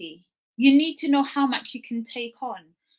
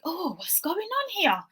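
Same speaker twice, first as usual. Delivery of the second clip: higher, softer, slow wondering; puzzled self-questioning; 7 semitones higher, 5 dB quieter than usual. Retold or imitated. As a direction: wonder aloud, taken aback. Retells her puzzlement at low mood.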